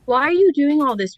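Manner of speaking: loud, high outraged demand; betrayed passenger shouting at the pilot; angry shout. Acted. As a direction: yell it at him in disbelief.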